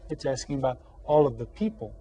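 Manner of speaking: normal talking voice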